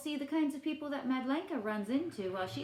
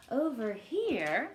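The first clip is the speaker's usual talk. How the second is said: ordinary delivery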